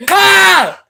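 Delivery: high, loud yelp; startled shout. Real, not acted. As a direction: yelp in surprise. Startled in game.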